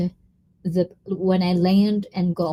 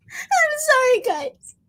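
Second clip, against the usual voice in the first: giggly apology; slower than usual. Real, not acted. Apology after squeal, laughing.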